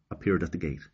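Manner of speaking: plain reading voice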